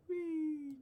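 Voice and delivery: a high gleeful squeal; mock delight at shrinking; playful whee. Real, not acted. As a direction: squeal like sliding down. Shrinking the camera.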